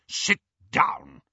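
a hint of a stern uncle; gruff uncle. A narrator's reading. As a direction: grumble it curtly. Vernon's gruff order.

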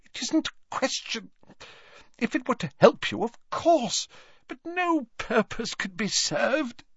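high, fretful Slughorn excuse; agitated old professor. A narrator's reading. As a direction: protest in a high, strained old voice.

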